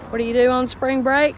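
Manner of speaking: ordinary delivery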